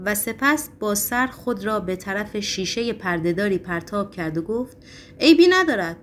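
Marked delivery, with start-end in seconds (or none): 5.2-6.0 loud, high exclamation; defiant cry. A narrator's reading. Patient about to hurl himself at window, crying defiantly. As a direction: throw it out boldly, pitched up.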